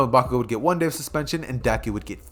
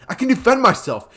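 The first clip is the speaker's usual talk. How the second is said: high, loud defiant cry; defiant shout; 9 semitones higher, 6 dB louder than usual. Acted. Boy finally fighting back against the bully, defiant shout. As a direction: stand your ground and yell it. Deku standing up to Bakugo.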